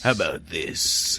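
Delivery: low slow Texas drawl; Southern accent. Shown as a demonstration. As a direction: drag it out like a Dallas villain. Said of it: "that sounds like a Southern guy".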